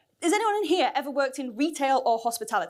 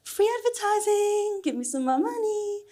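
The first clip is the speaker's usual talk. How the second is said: mock gleeful plug; 9 semitones higher than usual. Jokes about free advertising for employer.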